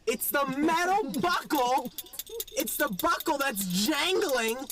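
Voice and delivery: worked-up mock tirade; exasperated outburst. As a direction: hammer each word at someone obtuse. Acted argument building with guard.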